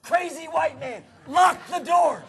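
loud, high cry of retold panic; mock alarm. Driver locking himself in from an angry pastor, mock-alarmed shout. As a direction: shout the warning, building on the second half.

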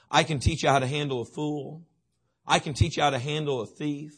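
usual speaking voice

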